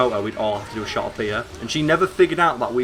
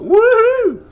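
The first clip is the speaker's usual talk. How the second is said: high, loud playful whoop; mock woohoo; 11 dB louder than usual. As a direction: toss out a cartoonish whoop of glee. Mimicking blade mode glee.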